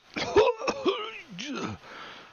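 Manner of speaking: thin strained cough, high up; high weak cough. Acted. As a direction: cough like a dying man. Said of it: "Soleil coughs".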